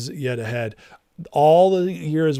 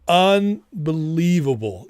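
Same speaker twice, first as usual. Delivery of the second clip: slow, stretched amazement; drawn-out awe; 3 semitones higher, slower than usual. Real, not acted. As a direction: let the word hang in disbelief. Stunned awe at victory.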